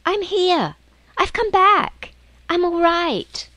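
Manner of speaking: loud, high, excited calling; shouting. Acted. Lucy shouting to the others that she's back. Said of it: "she shouted".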